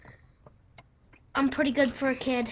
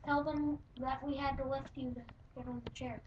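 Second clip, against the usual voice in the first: hushed aside to his friend; quiet prompting; 11 dB quieter than usual. Real, not acted. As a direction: murmur it off to the side.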